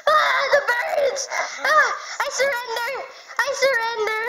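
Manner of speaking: high loud terrified surrender; panicked surrender shout. Acted. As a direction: scream your surrender in panic.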